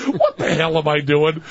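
talked through laughter; laughing. Real, not acted. Host on air mocking his own reading, laughing. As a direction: laugh at yourself as you ask it.

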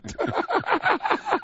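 high-pitched burst of laughing; real laughter. Real, not acted. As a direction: crack up at your own gag. Host cracks up at own gag.

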